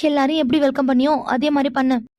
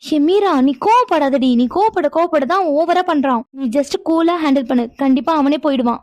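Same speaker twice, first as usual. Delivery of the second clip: normal talking voice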